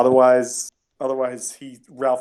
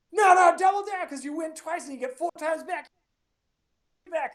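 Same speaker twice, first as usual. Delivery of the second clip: much higher, shouted mocking jeer; lazy-eyed heckler. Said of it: "the lazy eye guy is like".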